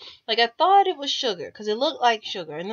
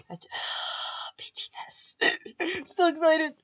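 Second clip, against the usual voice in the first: giddy whisper; 4 semitones higher, 5 dB quieter than usual. Real, not acted. Fan gushing before watching BTS.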